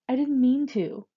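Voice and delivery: mildly tearful excuse; shaky, near tears. A narrator's reading. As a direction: plead as if you might start crying. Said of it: "His voice sounds shaky, like he might start crying".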